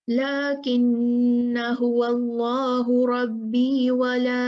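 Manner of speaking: slow measured tajweed recitation; the correct reading demonstrated, recited; Quranic recitation. Shown as a demonstration. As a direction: recite each word carefully and drawn out. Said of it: "correct recitation after explanation".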